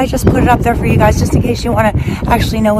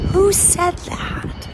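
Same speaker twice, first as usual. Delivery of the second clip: drawn-out, much higher "who said that"; mock outrage; 11 semitones higher, 5 dB quieter, much slower than usual. Vlogger scolding imagined neighbors with hammed-up indignation. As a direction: act scandalized, stretching every word.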